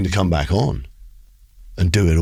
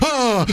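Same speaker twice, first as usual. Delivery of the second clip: startled scream. Retold or imitated. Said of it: "I was like".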